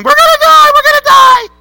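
very high, loud terrified scream; shrieking in mock terror at full volume; panicked screaming. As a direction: scream it out as if the boat is going down. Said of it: "You're screaming".

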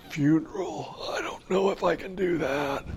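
whispery, then high timid protest; hammed-up frightened novice, softly squeaking; mock panic. Retold panic at funeral request.

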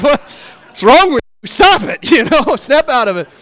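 mock scolding with a laugh; mock exasperated scolding. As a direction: playfully scold the kid. Father scolding son's plans, laughing.

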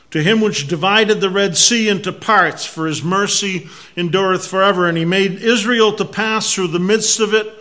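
loud, forceful scripture reading; preaching scripture. Acted. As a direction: proclaim each verse boldly.

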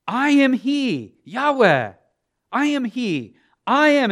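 loud, high proclamation as God; booming preacher voicing God; emphatic divine declaration. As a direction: thunder out the name, drawn out. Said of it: "God is emphatically putting himself out there".

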